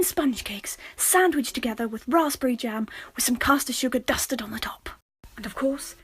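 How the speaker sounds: soft hushed lecture; soft whispered documentary read; mock documentary whisper. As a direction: whisper the history like a secret. Whispered history segment.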